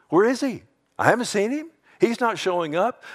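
high, scoffing disbelief; mocking. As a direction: taunt as if he will never show. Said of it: "You know what mockers do?".

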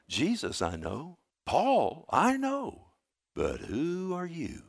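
slow, drawn-out demon quote with big pitch swings; acted demon, deliberate and softening at the end; demon's taunting reply. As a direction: challenge them slowly, stretching each name. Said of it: "you know what the demon said".

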